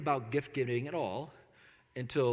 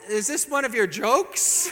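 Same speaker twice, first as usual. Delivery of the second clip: suspicious wife; 7 semitones higher, 8 dB louder, slower than usual. Retold or imitated. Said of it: "she's looking at me".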